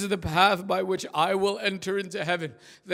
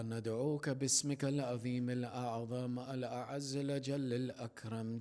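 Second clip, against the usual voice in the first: soft, fast Arabic prayer murmur; quiet dua recitation; 8 semitones lower, 9 dB quieter, much faster than usual. Acted. Imam leading the congregation's dua, soft fast prayer. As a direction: recite the names quickly and low.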